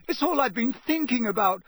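high, loud, tearful, slowing on the last words; sobbing. A narrator's reading. Mother sobbing over her twins, fearing the worst. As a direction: cry it out, full of guilt. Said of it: "starting to sob".

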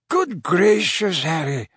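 slow, drawn-out astonishment, starting high; surprised. Acted. Dumbledore seeing Harry late at night, surprised. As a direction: open high in surprise, then drop on the name. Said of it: "said Dumbledore in surprise".

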